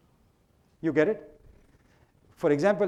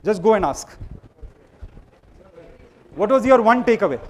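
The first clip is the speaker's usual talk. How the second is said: raised, high voice over the noise; calling out to crowd; 3 semitones higher, 8 dB louder than usual. Real, not acted. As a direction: shout instructions across the room.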